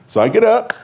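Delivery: usual speaking voice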